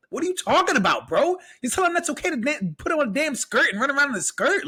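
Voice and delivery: high-pitched incredulous protest; exasperated, pitch swooping well up; indignant father. Acted. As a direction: act out a dad's outraged disbelief. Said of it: "as a father, I'm just like".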